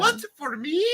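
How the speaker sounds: directed surprise. Shown as a demonstration. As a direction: show her how to react. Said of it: "take it all in, and then react".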